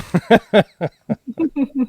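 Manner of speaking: high burst of laughter; loud laugh. Real, not acted. Laughing after finishing scene.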